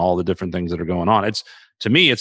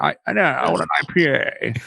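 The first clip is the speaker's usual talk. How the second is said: higher-pitched, affected mock snob; pretentious put-on voice; 5 semitones higher than usual. Ordering an IPA in the changed voice, pretentious. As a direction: copy a friend's silly beer-snob voice. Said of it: "I'm gonna have to say it like that".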